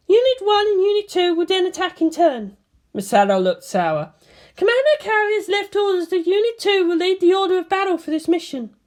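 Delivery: high woman's voice, briefing; voiced commanding officer. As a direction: report damage like an operations chief. Misato briefing.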